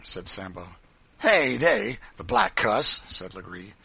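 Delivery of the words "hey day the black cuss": loud, raised brutish exclamation; forceful, contemptuous outburst; blustering master. A narrator's reading. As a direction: bluster like a cruel boss. Legree reacts to the report.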